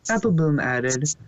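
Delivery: usual speaking voice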